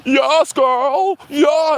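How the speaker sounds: high, loud sassy cheer; mock flamboyant backup. Mocking the cheering men in the studio audience, high. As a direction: hype it up like a sassy fan. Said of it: "men in the background like".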